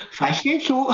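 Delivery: high falsetto through a laugh. Shown as a demonstration. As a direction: giggle it up in head voice.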